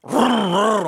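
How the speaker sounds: high, loud answering revs; engine roar imitation. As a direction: rev back like a stronger car. Imitates BMW revving back.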